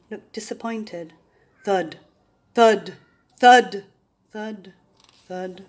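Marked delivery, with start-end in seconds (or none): none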